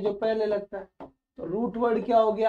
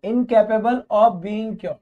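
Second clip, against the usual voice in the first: very slow and louder, word by word; slow loud dictation; 6 dB louder, much slower than usual. Shown as a demonstration. Teacher reading a definition for students to copy, slow and loud. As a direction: dictate it slowly and clearly.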